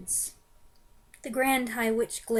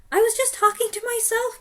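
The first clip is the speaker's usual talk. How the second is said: very high, frightened cry; crying witch; 13 semitones higher, 6 dB louder than usual. Acted. Front-row witch crying out her excuse in terror. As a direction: wail the excuse. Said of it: "cried the wicked".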